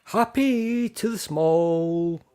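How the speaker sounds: drawn-out, high slogan; slow proclamation. Shown as a demonstration. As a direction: unveil a catchphrase slowly. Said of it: "I've invented a new thing".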